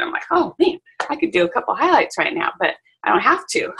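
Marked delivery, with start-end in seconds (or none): 0.3-2.5 higher, eager rush of ideas; a little excited voice at work; lightly excited quote; mock eager. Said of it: "like, excited again".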